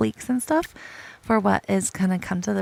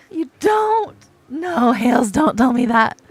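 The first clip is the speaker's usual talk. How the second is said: loud protest; 8 dB louder than usual. Real, not acted. Protesting at the game.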